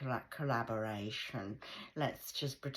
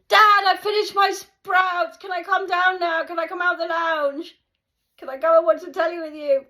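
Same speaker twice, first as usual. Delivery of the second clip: put-on much higher, loud, pleading child's shout; child brother pleading; 16 dB louder than usual. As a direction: yell it like a kid begging to leave the table. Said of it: "My brother".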